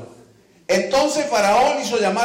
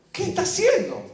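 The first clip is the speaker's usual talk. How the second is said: high incredulous repeat; 9 semitones higher, 3 dB quieter than usual. Shown as a demonstration. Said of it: "repeats the question".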